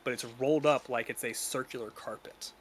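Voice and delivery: usual speaking voice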